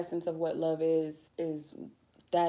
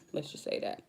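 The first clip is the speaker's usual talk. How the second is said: laughing aside; 7 semitones lower, 4 dB quieter than usual. Real, not acted.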